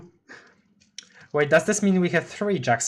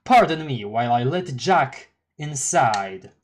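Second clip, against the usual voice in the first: normal talking voice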